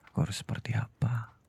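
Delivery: a breathless whispered plea; desperate whisper. Acted. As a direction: ask it under your breath, helpless. Husband asking wife in despair. (bisikan putus asa kepada istri)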